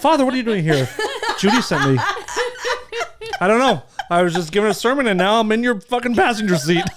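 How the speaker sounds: high, loud, laughing mock priest; hammed-up startled priest. Joking about the aunt's ghost, a giggling priest voice. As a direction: play a flustered priest caught in a car, cracking up. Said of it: "Like a pro-, high priest in the church?".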